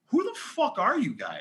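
high-pitched mock disbelief; imitating someone totally incredulous. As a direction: retell it as a baffled challenge. Retold baffled editor challenging unknown DJs.

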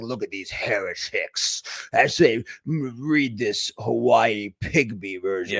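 low, slow sneering critic voice; mocking critic. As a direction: drag it out like a smug accuser calling them heretics.

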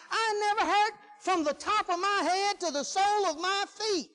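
high, insistent preacher's storytelling; animated preaching testimony. Acted. Preacher tells congregation how badly he hurt, animated testimony. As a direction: build the tale in a high, driving preacher's voice.

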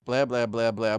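loud dismissive blah blah; mocking blah blah. Shown as a demonstration. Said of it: "it's like".

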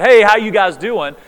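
bright greeting called across the room; hollered hello to a room of boys; cheery call out. Retold or imitated. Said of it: "yelled out for my son".

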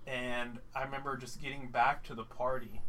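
ordinary talk, nothing marked

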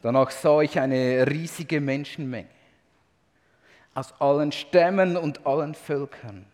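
loud, high, proclaiming reading of the heavenly praise; scripture reading. Acted. Preacher reading Revelation to congregation, loud proclaiming voice. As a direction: read the vision as if shouting praise with the crowd. Reads Revelation aloud with fervor.

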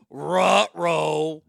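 loud, drawn-out "ruh-roh"; mock alarm like a cartoon dog; goofy dog-speak catchphrase. Cartoon dog catchphrase repeated in mockery.

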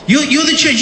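put-on lively preacher voice at a child; preaching to his kids. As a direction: drive it home to the kids, playfully. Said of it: "Preaching to him".

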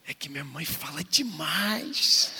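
a hint of a whining boy, slow and drawn out; lightly voiced boy confiding, dragging the words; imitated upset son. Son confiding in his father about his mother, mildly plaintive.

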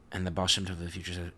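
usual speaking voice

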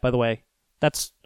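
ordinary delivery

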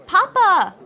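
high, loud happy call; loud greeting. A narrator's reading. Daughter answering her father's greeting as they embrace, loud. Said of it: "I responded just as loudly".

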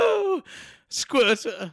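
high comic squeal; silly high-pitched blurt. Pretend. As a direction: pipe up high with a silly interjection. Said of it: "say squirter in a high-pitched voice".

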